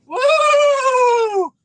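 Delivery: high loud joyful whoop; excited whoop. Real, not acted. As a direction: whoop with delight. Excited whoop over big fish.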